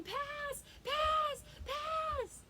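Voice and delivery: put-on a six-year-old screaming "Pass!" on the field; child yelling pass. Retelling a young player yelling, high child shout.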